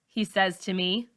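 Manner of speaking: ordinary delivery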